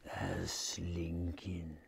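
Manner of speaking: low, slow whispered dread; creepy low whisper; ominous whisper. A narrator's reading. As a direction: hush it like danger is near. Ominous arrival of the gator.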